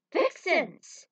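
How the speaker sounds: high, loud call to the henchwomen; barked command. A narrator's reading. Villain calls his Vixens, loud command. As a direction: summon them with a sharp shout.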